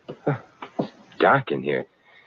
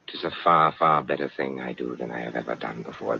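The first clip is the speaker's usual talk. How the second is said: mock Sidney Carton; 11 semitones lower, 6 dB quieter than usual. To Alice, hamming up a noble Sidney Carton farewell. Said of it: "it's like Sidney Carton".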